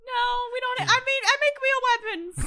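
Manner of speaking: a small weapon maker presents himself; proud little elf. Acted. GM voices Fudge the elf.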